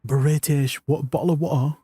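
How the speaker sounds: mock British with dropped t's; comic British bottle of water; British accent. Shown as a demonstration. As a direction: put on a glottal-stop British accent. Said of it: "I can do a British accent".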